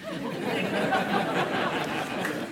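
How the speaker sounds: quiet breathy chuckle; soft laugh. Real, not acted. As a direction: let out a little laugh. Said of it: "laughing after demo".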